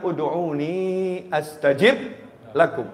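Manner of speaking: slow chanted Arabic verse; slow Quranic chant; Quranic recitation. Acted. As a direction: recite the verse slowly, melodic.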